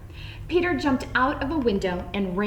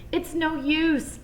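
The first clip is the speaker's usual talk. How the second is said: mock sob; 7 semitones higher, slower than usual. A narrator's reading. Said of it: "sobbed Peter".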